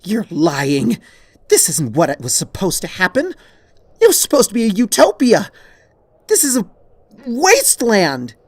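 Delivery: loud, rising, enraged accusation; furious shouting. A narrator's reading. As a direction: scream it at her with venom. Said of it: "as she shouts in anger".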